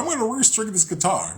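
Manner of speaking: sing-song carefree self; light voice of a cheerful past self; mock past self. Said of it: "I was like".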